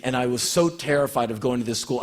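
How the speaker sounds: ordinary talk, nothing marked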